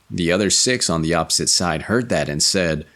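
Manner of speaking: ordinary delivery, nothing marked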